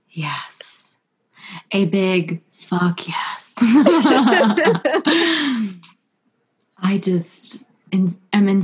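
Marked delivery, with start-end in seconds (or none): 0.1-3.5 slow, whispered emphatic yes; a fervent hushed affirmation. Real, not acted. 3.6-5.7 a burst of delighted laughter; happy laughter; bright laugh. Real, not acted. Laughs after the whispered yes.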